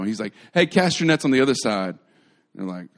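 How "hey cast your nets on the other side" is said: loud, raised teasing call; shouted across the water, tongue in cheek; sarcastic. Acted. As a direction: call out advice with knowing sarcasm. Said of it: "if you don't think the Bible's sarcastic".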